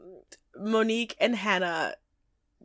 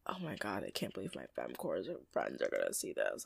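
low, much softer, murmured George voice; mock George; 17 dB quieter than usual. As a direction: drop way down and mutter it like George. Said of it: "George is like".

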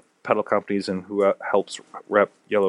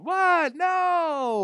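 much higher, shouted "What? No!"; Jerry Seinfeld. Shown as a demonstration. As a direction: yell the outrage like a sitcom star. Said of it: "I almost kinda sound like Jerry Seinfeld".

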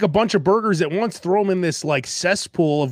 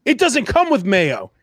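retold interruption; 5 semitones higher than usual. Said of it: "before I even finished my sentence, she's like".

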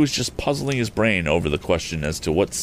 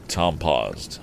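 plain reading voice

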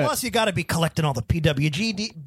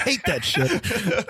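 slow Stone Cold impression; much slower than usual. Continues Stone Cold parody.

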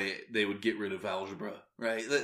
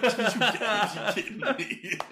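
slow, much higher, laughing mock disbelief; retold disbelief; 11 semitones higher, 4 dB louder, slower than usual. Retelling reaction to own headline mistake, higher laughing disbelief. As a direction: laugh it out as if caught by your own slip. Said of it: "and I was like".

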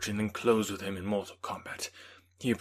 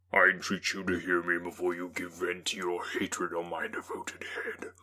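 entreating whisper; 2 semitones lower than usual. A narrator's reading. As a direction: whisper it as a monster's plea. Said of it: "He easily eluded me and said".

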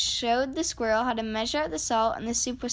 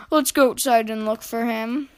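plain reading voice

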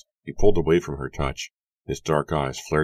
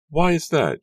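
very high, louder playful question; teasing; 14 semitones higher, 6 dB louder than usual. A narrator's reading. Susanna teasing Edward about their meeting, high and playful. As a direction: tease him with a coy, high-pitched lilt. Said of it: "she teased".

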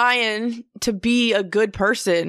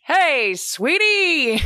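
slow high transatlantic "Hey, sweetie"; hammed-up old movie transatlantic drawl; transatlantic accent; 9 semitones higher, 3 dB louder, much slower than usual. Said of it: "The transatlantic accent".

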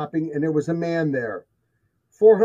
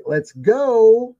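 raised, higher-pitched mock scream; retold yell, louder and higher; imitated scream; 6 semitones higher, 7 dB louder than usual. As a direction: call it out like a wife urging her husband to leave. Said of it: "the wife is screaming like".

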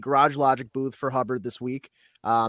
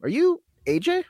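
fan's question. Retold or imitated. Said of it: "he goes".

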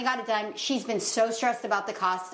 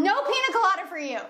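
higher, quick-fire joking comeback; mock parental refusal; 7 semitones higher, faster than usual. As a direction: playfully lay down the law to a kid. Acted comeback to child.

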